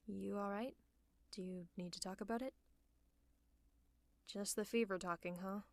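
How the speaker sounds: hushed, soothing bedside voice; gentle bedside concern. Acted. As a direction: talk gently to a sick friend. Tending to feverish, sleepy hero.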